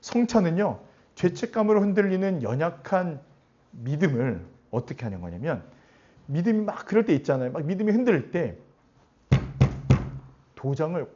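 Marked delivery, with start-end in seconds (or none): none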